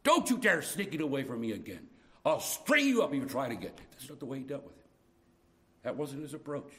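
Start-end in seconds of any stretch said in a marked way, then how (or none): 0.0-3.7 harsh rebuke. Acted. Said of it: "He doesn't say to Adam".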